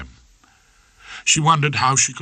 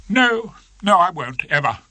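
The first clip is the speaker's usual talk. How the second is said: higher, fast upset voice; lightly voiced Miss Trant, distressed; young barrister giving up, upset; doleful young barrister; 10 semitones higher, 4 dB louder than usual.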